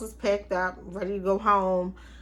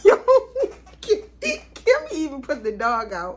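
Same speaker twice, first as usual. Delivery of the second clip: laughing; 6 semitones higher, 7 dB louder than usual. Real, not acted. Laughing at Kimmy's story.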